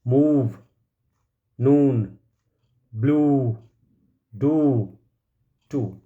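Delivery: each of मूव, नून, ब्लू, डू, टू said separately with a long oo; long oo vowel. Shown as a demonstration. Teacher reading examples of the long sound, मूव, नून, ब्लू, डू, टू stretched. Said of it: "sound थोड़ा लंबा हो जाएगा".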